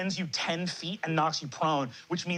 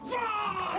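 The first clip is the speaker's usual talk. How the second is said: high, strained curse; Porter's frustrated yell. Acted. Porter learns his scheme failed, high frustrated yell. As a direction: explode in acted frustration, pitch shooting up. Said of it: "Porter goes".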